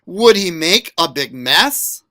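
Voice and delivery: ordinary delivery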